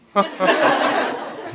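breathy silent laugh. Real, not acted. Breathy laugh at the partner's joke. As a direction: laughs without voice.

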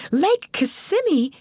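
high, drawn-out astonished echo; surprised. A narrator's reading. Alice interrupting manager at the lake's name, surprised. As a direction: exclaim the name slowly in high-pitched surprise. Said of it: "exclaimed Alice DeVere in surprise".

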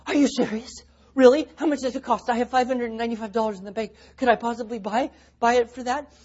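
high, loud, fast eager questions; imitating the son's giddy excitement; excited kid. Imitating the son asking dad about the software, high and excited.

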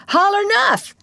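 put-on high, loud boyish yell; triumphant yell. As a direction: yell it down at him from on top. Tom pounding the boy, demands surrender.

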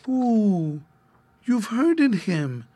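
put-on high, slow childish lament; acted small child about to cry; tearful little girl. A narrator's reading. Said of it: "little Hepzebiah began to cry".

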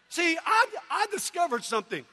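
usual speaking voice

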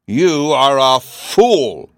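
enraged king exclaiming; furious exclamation. A narrator's reading. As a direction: hurl it slowly, every word loud. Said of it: "he exclaimed".

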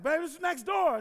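high, loud alarmed call; acted alarm. Husband telling wife of the shooting next door, acted alarm.

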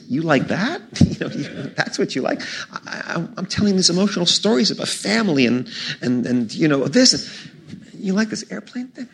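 incredulous, half-laughing complaint at the audience; mock indignation. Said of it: "I was kind of mad about this".

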